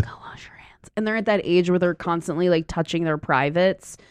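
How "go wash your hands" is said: whispered command. Retold or imitated. Sitter telling kid to wash hands, whispered. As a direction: whisper it urgently. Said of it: "I'm like".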